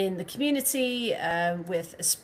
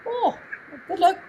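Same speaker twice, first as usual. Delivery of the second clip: laughing; 7 semitones higher, 5 dB louder than usual. Real, not acted. Teasing travelling rival fans.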